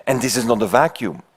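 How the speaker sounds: ordinary delivery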